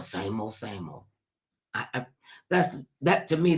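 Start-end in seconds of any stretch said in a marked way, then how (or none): 0.0-1.0 mock flat mumbled reply, dropping low and soft; a hint of a bored woman; glum reply. Imitating a bored woman answering her friend. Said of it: "can't even get a mini praise report".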